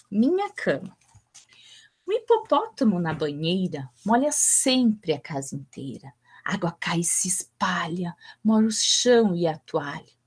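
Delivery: put-on expressive children's poem recital, voices shifting with the lines; poem reading. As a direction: read it like a storyteller for small children. Reads a children's poem aloud. (leitura expressiva de poema infantil)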